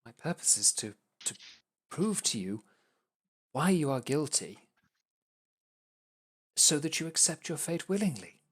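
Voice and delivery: high, pleading explanation; exasperated rookie lawyer. Acted. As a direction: insist you are doing your job right. Ertos argues with players.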